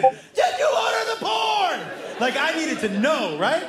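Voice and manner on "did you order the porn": mock courtroom shout, higher and slow; hammed-up film cross-examination; Tom Cruise interrogation. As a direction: bark the question like a movie lawyer. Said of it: "I've become Tom Cruise from A Few Good Men".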